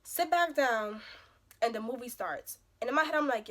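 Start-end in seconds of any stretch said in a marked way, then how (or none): none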